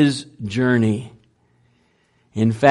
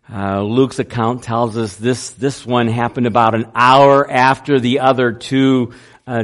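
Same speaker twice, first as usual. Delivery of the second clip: ordinary talk, nothing marked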